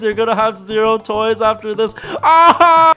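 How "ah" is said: high playful scream; mock scream. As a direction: shriek in fake horror. Toys being eaten.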